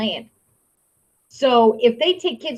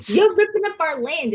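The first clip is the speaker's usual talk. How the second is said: mock whiny protest; 6 semitones higher than usual. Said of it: "get all pissy all over again saying".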